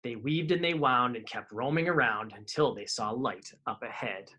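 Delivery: put-on lively read-aloud for kids; storybook reading. A narrator's reading. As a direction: tell the rhyming tale to children. Reading picture book aloud.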